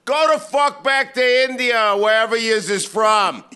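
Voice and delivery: hammed-up road-rage screaming; mock yelling. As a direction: bellow it out the car window. Racist driver yelling at worker.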